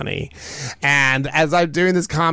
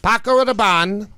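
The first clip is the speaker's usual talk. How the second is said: high, louder Paco Rabanne with a rising flourish; grand announcement; 8 semitones higher, 4 dB louder than usual. Pretend. Playful name echo.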